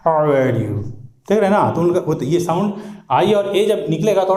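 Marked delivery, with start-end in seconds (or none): 0.1-0.8 low American-style "How are you"; demonstrated American accent, pitched down. Shown as a demonstration. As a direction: imitate an American with throaty R. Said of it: "American लोग ऐसे ही बोलते हैं".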